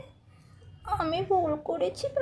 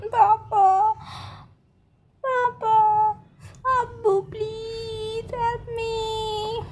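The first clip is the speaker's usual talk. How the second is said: put-on crying child; 5 semitones higher, much slower than usual. Little girl crying to her father for help, sobbing. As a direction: wail for your father like a hurt little girl.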